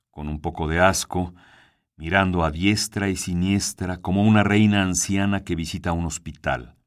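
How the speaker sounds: ordinary delivery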